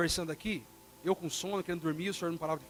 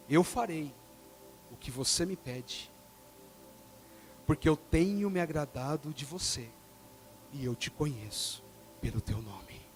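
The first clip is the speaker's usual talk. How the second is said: lower, sinking voice of God; 6 semitones lower, 4 dB quieter than usual. Retold or imitated. God answers Moses in a low, soft voice. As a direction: let God's promise sink low and soft.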